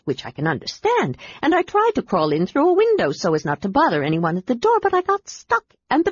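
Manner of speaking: bubbly little adventurer who can't stop talking; excited kender. Acted. Kender Tass chatters excitedly.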